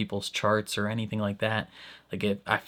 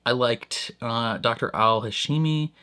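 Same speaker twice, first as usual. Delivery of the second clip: ordinary talk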